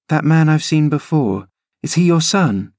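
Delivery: put-on a girl urging her nurse uphill; eager young Cathy. Cathy asks about Hareton.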